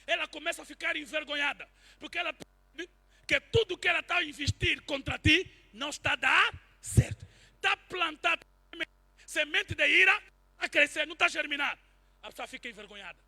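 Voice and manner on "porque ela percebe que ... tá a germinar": loud, slowing preacher's shout; rising to a fiery sermon shout; preaching at full force. Acted. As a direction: drive it home like a pulpit climax.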